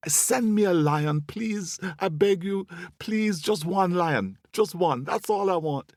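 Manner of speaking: high-pitched pleading to the sky; desperate, hammed-up squirrel prayer; begging squirrel. A narrator's reading. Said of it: "he went down on his knees".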